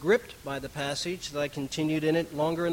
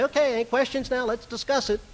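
higher, lightly imitated call for questions; imitating a preacher turning to discussion; preacher inviting questions; 8 semitones higher than usual. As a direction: briskly open the floor, pitched up. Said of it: "he'd finish and he'd say".

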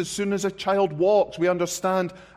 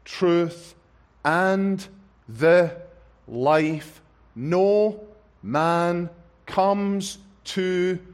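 word by word reading; slower than usual. Acted. Scripture read word by word.

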